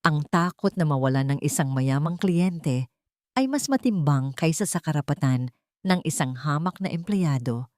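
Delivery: usual speaking voice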